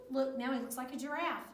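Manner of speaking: plain reading voice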